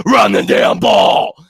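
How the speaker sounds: slow, loud drawn-out yell; screaming frustration. Real, not acted. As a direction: yell it at the TV, stretched out. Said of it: "from all the screaming".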